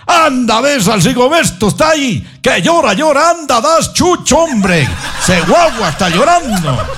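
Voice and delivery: high mock scolding shout; hammed-up order to go tend the crying baby; bossy mother.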